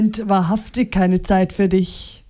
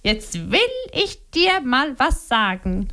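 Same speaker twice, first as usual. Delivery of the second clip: Liliana, fired up; 2 semitones higher than usual. A narrator's reading. Wife about to tell her husband off, fired up. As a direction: launch into a telling-off. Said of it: "rief sie".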